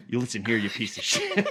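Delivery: playful angry threat while pointing; mock furious threat. Shown as a demonstration. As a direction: bark it at someone who made you mad. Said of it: "it's just like".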